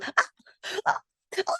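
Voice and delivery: lightly voiced queen cutting in, half whispered; mock queen interrupting. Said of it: "the queen goes".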